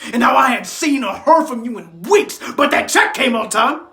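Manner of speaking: loud, hurt reproach; bitter accusation. Acted. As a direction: hit the words hard, accusing the absent one. Bitter repeat to absent father.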